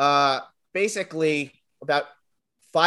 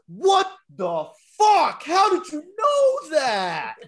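much higher, very slow, drawn-out outraged yell; shocked shout; 7 dB louder, much slower than usual. Retold or imitated. A kid in the theater stunned by the spoiler, drawn-out shout. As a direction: yell it out, stretched in disbelief. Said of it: "this one kid from Connecticut go".

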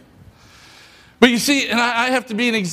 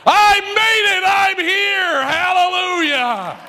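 pretend yelling; 11 semitones higher, slower than usual. Pastor imagining his arrival in heaven, yelling joyfully. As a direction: bellow it like arriving at heaven's gate. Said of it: "screaming and yelling".

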